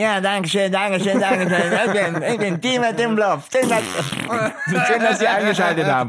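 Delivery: high, gushing show host thanks. Pretend. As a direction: play the flattered star host. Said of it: "die Moderatorin von dieser Show".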